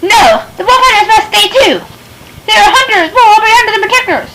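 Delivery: high, hurried protest; insistent villager. Acted.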